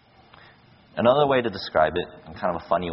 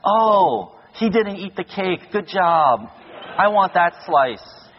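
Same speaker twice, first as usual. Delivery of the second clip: high, slow, louder mock sweetness; mock guest; 8 semitones higher, 5 dB louder, much slower than usual. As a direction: ham up a sarcastic party guest praising the cake. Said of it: "You're not gonna be like".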